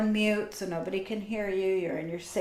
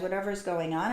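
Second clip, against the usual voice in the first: usual speaking voice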